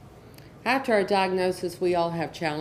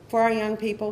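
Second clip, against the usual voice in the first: usual speaking voice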